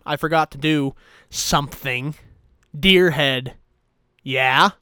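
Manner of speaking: usual speaking voice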